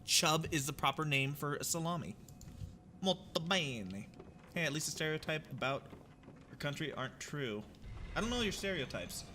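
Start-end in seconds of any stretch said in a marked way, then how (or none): 3.0-4.1 slow, drawn-out mock Italian; hammed-up Italian flourish; Italian accent. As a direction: savor it in a stagey Italian accent. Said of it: "as the Italians would say".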